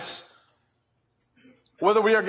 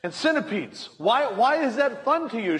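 exasperated wife; 7 semitones higher than usual. Retold or imitated. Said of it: "And she's like".

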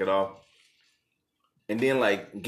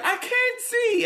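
very high, slow wailing cry; hammed-up crying girlfriend; mock tearful shout; 4 dB louder, slower than usual. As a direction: shriek it through fake sobs. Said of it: "you was like".

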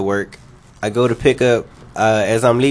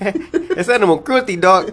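laughing; 8 semitones higher than usual. Real, not acted.

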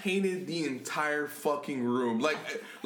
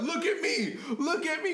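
urgent friend; 12 semitones higher than usual. Retold or imitated. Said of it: "He's like".

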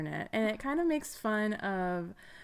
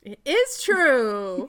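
emphatic reveal; 4 semitones higher, 8 dB louder, much slower than usual. Acted. Game host reveals answer.

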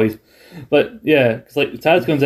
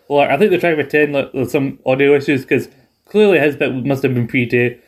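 ordinary talk

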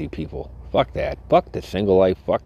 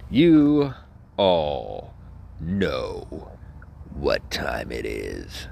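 drawn-out announcing; 4 semitones lower, much slower than usual. Pretend. Host teasing the segment to listeners, slow descending announcement.

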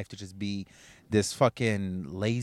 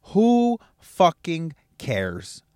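drawn-out, raised and hard; loud slow rhetorical jab; slow loud emphasis; 5 semitones higher, 4 dB louder, much slower than usual. Real, not acted. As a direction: lean on every word of the question. Motivational rant peak.

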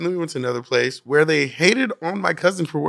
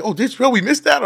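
higher, fast mocking whine of the friends; mock regret; 9 semitones higher, faster than usual. Mimicking friends who missed Dave Chappelle, high fast whine. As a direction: whine it quickly in fake regret. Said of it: "they're like".

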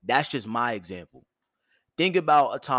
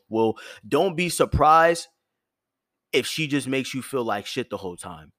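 ordinary talk

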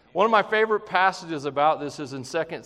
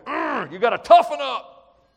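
tough shout; 8 semitones higher, 9 dB louder than usual. Pretend. Marine dad, tough talk.

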